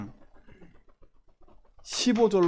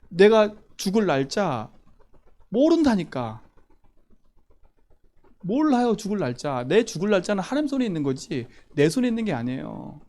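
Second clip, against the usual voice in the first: ordinary talk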